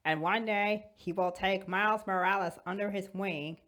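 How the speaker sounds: ordinary talk, nothing marked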